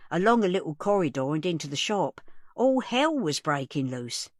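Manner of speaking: plain reading voice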